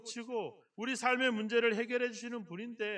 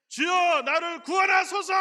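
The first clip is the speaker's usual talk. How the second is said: full-throated shout, much higher and louder; drowning man's cry; 7 semitones higher, 9 dB louder than usual. Shown as a demonstration. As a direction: yell for rescue like you're drowning. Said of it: "목이 쉬게 막".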